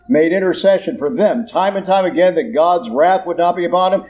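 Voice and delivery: normal talking voice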